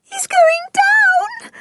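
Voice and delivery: very high, drawn-out wail; weeping. A narrator's reading. Anne watches the dog sink, weeping wail. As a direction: wail it slowly in tearful distress. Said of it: "wept Anne".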